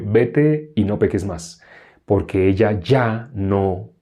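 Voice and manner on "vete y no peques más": slow, measured pardon; understated, unhurried authority; Jesus's calm command. Acted. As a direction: speak slowly and simply as Jesus. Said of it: "¿por qué le dijo a la mujer sorprendida en adulterio".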